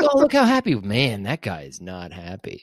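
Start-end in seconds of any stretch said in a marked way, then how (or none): 0.0-0.8 mock cheerful passerby.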